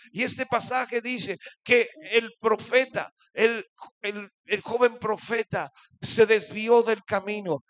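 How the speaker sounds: full-force preaching. Acted. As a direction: proclaim it to the congregation. Preaching, loud, some slowed lines. (predicación fervorosa)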